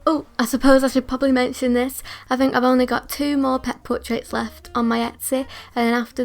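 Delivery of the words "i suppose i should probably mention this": normal talking voice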